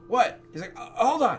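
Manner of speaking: surprised gnome. Acted.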